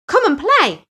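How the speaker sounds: high, loud cheerful invitation; friendly call. Acted. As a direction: coax a friend to play. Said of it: "said his friend Splash".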